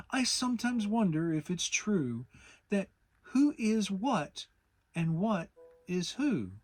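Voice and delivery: poem recital. Acted. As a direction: recite it like proud Pooh. Pooh recites his poem.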